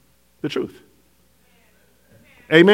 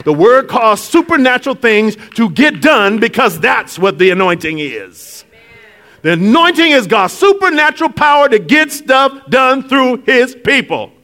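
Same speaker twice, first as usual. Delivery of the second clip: high, rising preaching over music; climactic preaching; 6 semitones higher than usual. Acted. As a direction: lift the congregation to the climax.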